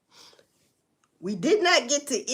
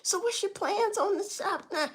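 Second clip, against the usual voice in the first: mock reporter; 7 semitones higher, 5 dB quieter than usual. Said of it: "the news people asked".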